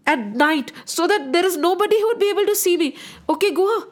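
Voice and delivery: agitated Sumantra. Acted. Voices Sumantra dreading return.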